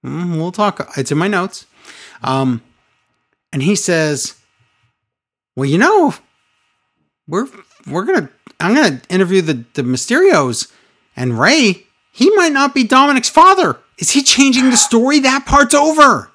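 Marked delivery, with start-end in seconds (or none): 5.6-16.3 high, loud mocking voice; a rising, ranting mock of him; mocking Rollins voice.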